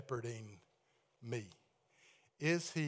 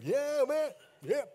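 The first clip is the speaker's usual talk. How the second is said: higher, louder mock voice of someone in the back; imitating a congregant; 8 semitones higher, 5 dB louder, slower than usual. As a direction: echo the guy in the back pew. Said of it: "I heard that back there".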